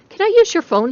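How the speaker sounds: high loud pleading question; urgent plea. Acted. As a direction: beg urgently for the phone.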